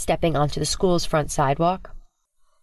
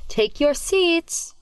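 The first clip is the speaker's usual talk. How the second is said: stern teacher; 12 semitones higher, much slower than usual. Acted. Stern teacher commands class.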